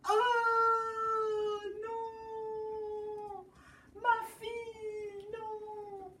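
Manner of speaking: high, mock wailing for his daughter; hammed-up weeping king. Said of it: "Il pleure fort".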